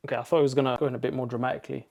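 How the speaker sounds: ordinary talk, nothing marked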